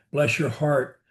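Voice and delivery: ordinary talk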